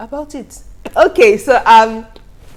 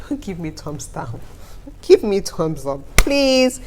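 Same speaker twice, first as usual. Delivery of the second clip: pleading; 2 semitones higher than usual. Real, not acted. Playful plea to viewers.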